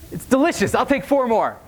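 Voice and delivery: high, tipsy delight over drinks; giddy drunk boast. Retold or imitated. Tipsy guy at the bar gushing over his Manhattan, high and giddy. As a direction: gush like a tipsy newbie.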